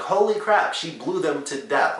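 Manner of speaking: high, loud burst of mock shock; shocked exclaim. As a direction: blurt it out like you can't believe it. Retelling own shock at explosion.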